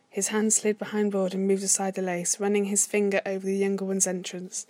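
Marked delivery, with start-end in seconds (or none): none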